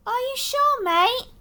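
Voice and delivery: high doubtful Australian question; worried Australian bird asking; Australian accent. Acted. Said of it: "Australian accent".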